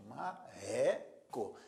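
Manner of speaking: "Marreco" very slow, ma-RRE-co, middle stressed. Shown as a demonstration. Teacher showing the strong middle syllable, "Marreco" split ma-RRE-co. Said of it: "é a mais forte".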